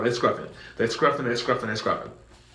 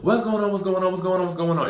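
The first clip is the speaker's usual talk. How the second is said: high, repeated fading "what's going on"; a nosy crowd, calming down each time; crowd asking; 7 semitones higher, faster than usual. Retold or imitated. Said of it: "everybody got their phones out".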